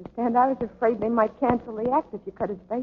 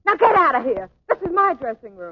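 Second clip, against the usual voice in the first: high, loud, ordering him out; furious order; 6 semitones higher, 6 dB louder than usual. Acted. Dancer ordering her bullying partner out of her dressing room, loud and furious.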